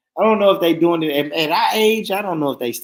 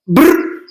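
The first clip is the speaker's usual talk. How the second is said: mock gun burst; 12 semitones higher, 7 dB louder than usual. Shown as a demonstration. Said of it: "imitating gun burst".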